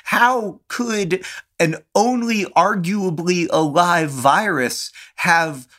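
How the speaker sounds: high, slow mock indignation; mock outrage. Brother sympathizing with outrage, high and slow. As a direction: rail against the virus, hammed up. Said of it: "in a somewhat angry way".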